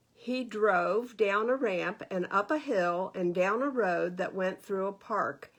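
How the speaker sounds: usual speaking voice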